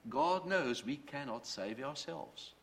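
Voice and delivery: usual speaking voice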